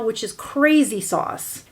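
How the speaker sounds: ordinary delivery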